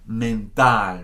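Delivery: mental with open final ah; Spanish mental. Shown as a demonstration. Teacher models Spanish mental, open ah ending. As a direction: end mental on a pure ah.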